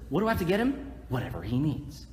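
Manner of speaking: ordinary talk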